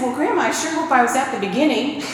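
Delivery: fast, laughing through the teasing reply; amused, laughter spilling into the words. Retold or imitated. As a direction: giggle out the punchline quickly. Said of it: "and I started laughing".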